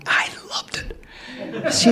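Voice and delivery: slow breathy confiding whisper; gleeful whisper. Real, not acted. Confessing to the audience he enjoyed it, in a slow whisper. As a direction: whisper it like a guilty pleasure. Gleeful aside about engineer crawling.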